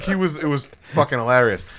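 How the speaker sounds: normal talking voice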